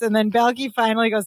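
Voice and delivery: usual speaking voice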